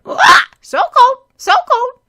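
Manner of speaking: very high, loud squawking parrot voice; a pet parrot mimicking its owner; parrot echo. A narrator's reading.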